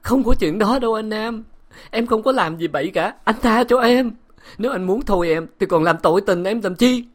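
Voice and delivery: high, loud, tearful begging; sobbing plea. A narrator's reading. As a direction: plead through sobs. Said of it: "Bội Thanh khóc ngất".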